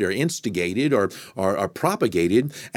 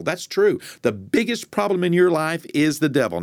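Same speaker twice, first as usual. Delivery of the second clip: fervent preaching; 6 semitones higher than usual. Acted. Preacher warning listeners their problem is the devil, fervent. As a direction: preach it, rising with conviction. Preaching builds to full force.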